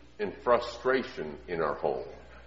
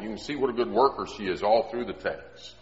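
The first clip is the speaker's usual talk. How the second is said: ordinary talk, nothing marked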